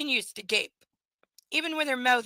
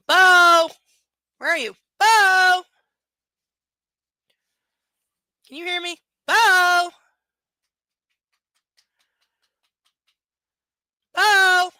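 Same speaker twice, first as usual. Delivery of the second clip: loud, higher calls for the dog; calling the dog; 6 semitones higher, 12 dB louder, slower than usual. Real, not acted. Owner calls her dog Bo from the room, loud calling. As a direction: call out across the house.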